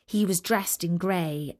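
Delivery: usual speaking voice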